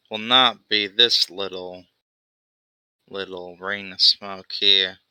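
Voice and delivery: laid-back goblin, amused and unbothered; lightly voiced goblin rogue. Goblin character's voice held.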